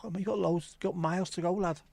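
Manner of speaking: high, friendly Scouse stranger's voice; voicing a Scouse stranger. To a lost lad walking home, high friendly voice. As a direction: pitch it up to play the friendly local lad. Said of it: "Where you going?".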